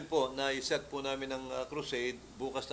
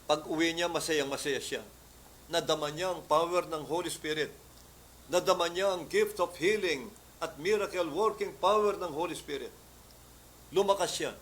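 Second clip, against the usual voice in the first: louder, higher preaching build; fervent preaching; 4 semitones higher, 5 dB louder than usual. Acted. Pastor preaching the healing to the church, fervent. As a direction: lift the congregation with it.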